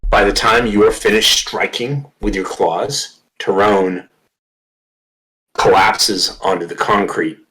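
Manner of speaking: slow, dramatic narration with heavy pauses; game master's scene setting. Acted. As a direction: punch the key words, then let each phrase fall away.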